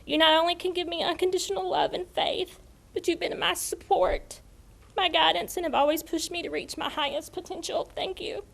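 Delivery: fighting back tears while thanking family; choked up. Real, not acted. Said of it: "excuse me".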